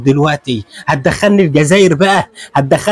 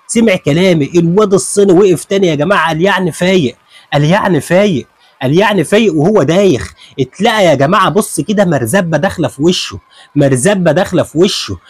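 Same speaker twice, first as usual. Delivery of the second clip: normal talking voice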